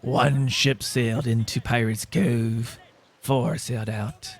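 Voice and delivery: low, slow pirate growl. Pretend. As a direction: play an old sea pirate, low and slow. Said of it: "How's my, how's my pirate, uh, voice?".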